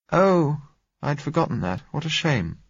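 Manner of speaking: high, mildly let-down oh; a touch of dismay at the news; lightly voiced disappointment; disappointed exclamation. A narrator's reading. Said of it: "she exclaimed disappointedly".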